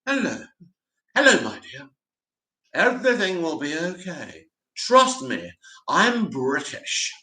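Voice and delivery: a hint of a smooth movie villain purring reassurance; British villain voice. Host mocks the Hollywood Brit villain, lightly put-on and sly. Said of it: "us Brits are only good as villains".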